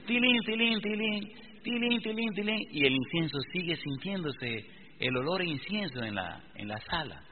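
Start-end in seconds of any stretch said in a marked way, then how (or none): 0.1-2.6 bell imitation. Imitating little bells again.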